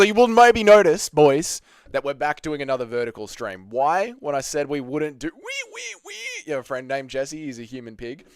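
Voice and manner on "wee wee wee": very high, soft piggy squeals. Acted. Streamer teasing a viewer as a human pig, high squeal.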